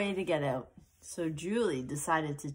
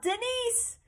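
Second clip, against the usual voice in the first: yelling. Acted. Girl trapped in a box calling her friend, high yell. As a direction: shout a name from inside a box. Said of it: "She yelled".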